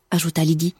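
usual speaking voice

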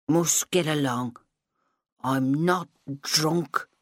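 very slow slurred insisting; drunk insisting. Acted. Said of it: "she insisted to an invisible audience".

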